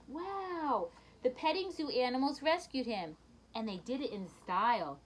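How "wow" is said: be delighted and amazed; amazed gasp. Real, not acted. Reader seeing the animals rescue the dog, amazed wow. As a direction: gasp then a rising wow.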